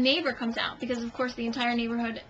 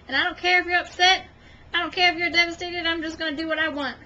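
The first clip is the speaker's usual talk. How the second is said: put-on louder, quick teenage defiance; sassy teenager; 4 semitones higher, 4 dB louder, faster than usual. Cat as teenager to her owner, mock defiant voice. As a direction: voice a cat as a bratty teen. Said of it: "She's a teenager now, so she's like".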